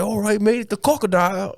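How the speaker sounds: high-pitched mock Aussie accent; Crocodile Hunter. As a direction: play an Australian croc wrangler, voice way up. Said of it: "the fucking crocodile hunter".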